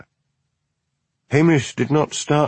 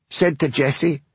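echoing chorus; 6 semitones higher than usual. Acted. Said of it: "chorused her sister".

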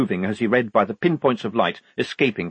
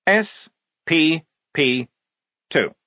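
higher, slow separate letters; reading aloud; 7 semitones higher, 4 dB louder, slower than usual. A narrator's reading. Lieutenant reading the code aloud, slowly spelling out. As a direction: call the code out one symbol at a time. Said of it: "he said aloud".

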